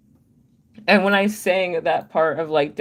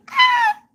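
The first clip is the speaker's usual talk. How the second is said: very high fangirl squeal; excited squeal; 6 dB louder than usual. Retold or imitated. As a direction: squeal with delight. Excited shriek at reply.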